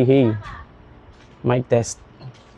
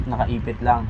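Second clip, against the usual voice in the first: ordinary talk, nothing marked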